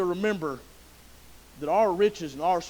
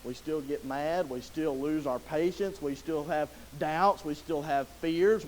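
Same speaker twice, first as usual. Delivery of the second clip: ordinary delivery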